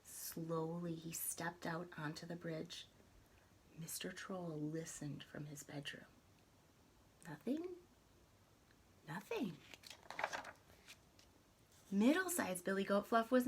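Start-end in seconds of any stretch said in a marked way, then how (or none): 0.1-9.6 hushed. A narrator's reading. Troll listens for goats.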